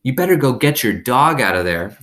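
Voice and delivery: a hint of a bossy kid; teasing boy. A narrator's reading. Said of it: "Dunlap said".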